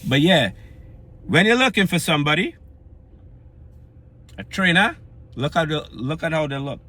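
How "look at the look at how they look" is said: ordinary talk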